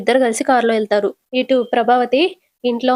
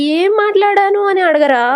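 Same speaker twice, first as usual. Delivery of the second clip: high-pitched, insistent demand; exasperated prompting; 9 semitones higher, 3 dB louder than usual. Acted. Mother nags her indifferent son to ask, exasperated. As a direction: prod him to ask, pitch raised throughout.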